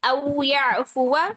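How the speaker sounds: औ याफुवा slow, final alif silent; slow careful औ याफुवा; Qur'anic recitation. Shown as a demonstration. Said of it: "अलिफ नहीं पढ़ा जा रहा है".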